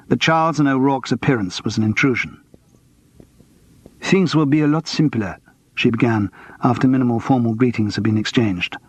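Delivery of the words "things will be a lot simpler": put-on heavy French accent, cool and clipped by resentment. A narrator's reading. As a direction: speak English as a curt Frenchwoman with a heavy accent. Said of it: "Her English was heavily accented".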